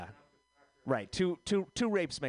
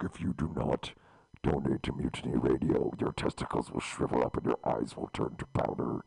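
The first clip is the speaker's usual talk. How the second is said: deep devil voice, very low with a rasp; 14 semitones lower than usual. Pretend. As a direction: loom as Satan, low and menacing. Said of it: "There's my devil voice".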